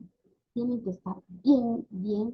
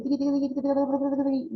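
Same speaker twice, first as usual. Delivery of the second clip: fast, higher-pitched stream of babble; imitated jargon babble; 4 semitones higher, much faster than usual. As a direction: rattle off nonsense syllables like a chattering child. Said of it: "esas jerguitas".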